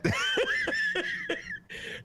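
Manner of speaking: sudden high laughing burst; high burst of laughter. Real, not acted. Laughing at retort.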